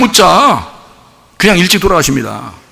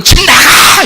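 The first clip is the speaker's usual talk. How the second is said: high mock yell with drawn-out end; parent's shout; 8 semitones higher, 4 dB louder, much slower than usual. Parent's nightly yell at a child, mock shouting. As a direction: imitate a furious parent heard next door. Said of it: "저녁마다 들리는 소리예요".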